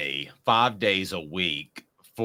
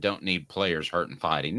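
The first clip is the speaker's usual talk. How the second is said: ordinary talk, nothing marked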